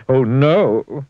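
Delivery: dismayed cry. A narrator's reading. Fussy sees wrecked bathroom.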